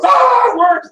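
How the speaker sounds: high, loud shriek of disgust; shrieking shout. Retold or imitated. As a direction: shriek it like the clerk seeing worms. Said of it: "the guy there goes".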